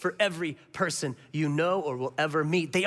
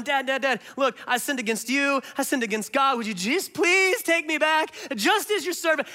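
pleading son; 6 semitones higher than usual. Retold or imitated. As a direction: beg your father to take you back. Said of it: "And the son's going".

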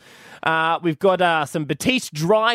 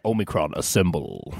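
deep robot-leader voice, much lower and softer on the command; Optimus Prime; 11 semitones lower, 5 dB quieter than usual. Acted. Co-host joking about the variant name, deep Autobot-leader impression. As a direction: drop low for a commanding cartoon Autobot call. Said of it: "Isn't that his voice?".

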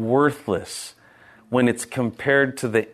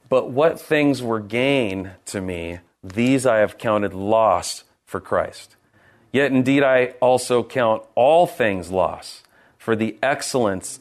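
scripture reading; 4 semitones lower than usual. Acted. Reads scripture aloud.

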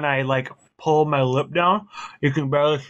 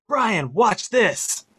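put-on higher, slow, drawn-out kid's boast; imitating excited kid; 6 semitones higher, much slower than usual. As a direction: draw it out higher, like a kid showing off. Acts out excited little kid's boast.

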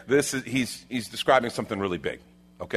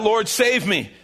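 high, loud acted plea; urgent raised-voice appeal; crying out; 10 semitones higher, 5 dB louder, slower than usual. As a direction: cry out for rescue as you sink. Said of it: "And he cried out".

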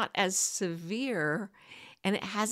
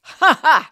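bursting out laughing; big amused laugh; loud laugh; 8 semitones higher, 15 dB louder than usual. Real, not acted. Laughs at own mix-up.

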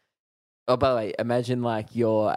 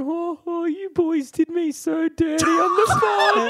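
much higher, louder mocking wail; mock Mitch complaint; 5 dB louder, slower than usual. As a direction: squeal it like a wounded tour manager. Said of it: "he went".

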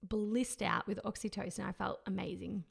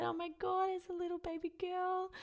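overjoyed; 9 semitones higher than usual. Retold or imitated.

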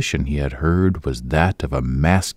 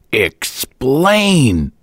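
higher, louder command; roaring; 8 semitones higher, 4 dB louder than usual. A narrator's reading. Boyce roaring at the leering old hag. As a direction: roar it. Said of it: "roared Boyce".

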